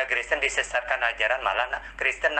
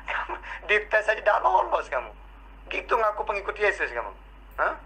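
mocking laugh; 7 semitones higher than usual. Real, not acted. Mocking opponent in debate.

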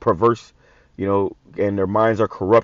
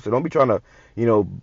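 usual speaking voice

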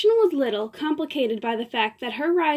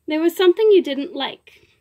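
accusing; 4 semitones higher, 6 dB louder than usual. Acted. Diva demands, pitched up.

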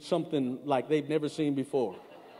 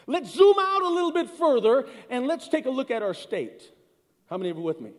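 louder each step, lifting "zoom"; preaching build; 6 semitones higher, 5 dB louder than usual. Acted. Preacher building to a climax for the congregation, lifting "zoom". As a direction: build the zoom-out like a rising call.

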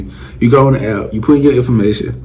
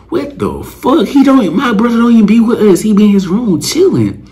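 outraged protest; 10 semitones higher than usual. Retold or imitated. Braxton protesting his brother's innocence, high and heated.

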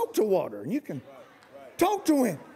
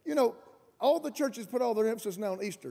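ordinary talk, nothing marked

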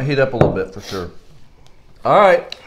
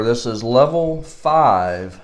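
slow, deliberate level call; slow announcement; much slower than usual. Real, not acted. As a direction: draw it out like a host.

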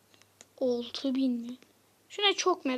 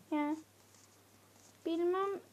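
ordinary delivery

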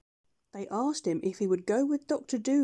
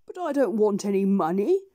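mild doctor; 6 dB louder than usual. Acted. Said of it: "said the doctor".

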